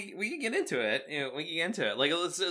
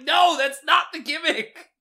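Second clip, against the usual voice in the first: much higher, louder protest; exasperated shout; 12 semitones higher, 6 dB louder than usual. Retold or imitated. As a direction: yell in frustration at the crowd. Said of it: "And he's like".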